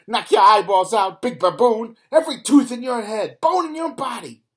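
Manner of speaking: high, very loud furious threats; fierce yell. A narrator's reading. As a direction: scream threats between blows. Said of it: "she yells".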